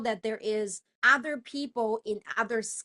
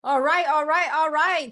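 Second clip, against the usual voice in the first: louder, higher call to the room; 5 semitones higher, 10 dB louder than usual. Real, not acted. New speaker calls the audience to order, loud. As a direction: grab the room's attention out loud.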